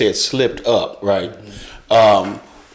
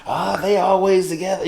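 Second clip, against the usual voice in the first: lightly imitated onlooker, pitched higher; gossiping onlooker; 7 semitones higher, 4 dB quieter than usual. Man imitating people who might look at that, lightly mocking gossip. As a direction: say it higher, as a suspicious bystander would.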